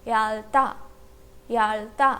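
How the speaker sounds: "याल्ता" low, as yal-ta with a clear L; pronunciation demo. Shown as a demonstration. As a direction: model "याल्ता" in two separate syllables, L closing the first. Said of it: "तो word का pronunciation क्या होगा?". ("याल्ता" का साफ़ उच्चारण, नीची आवाज़ में, ल साफ़)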